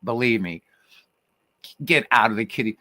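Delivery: usual speaking voice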